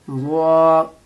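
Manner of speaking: वा said heavy with rounded lips. Shown as a demonstration. Teacher demonstrates round-lipped वा. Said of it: "होंठ को गोल करना है".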